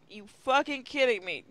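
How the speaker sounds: ordinary talk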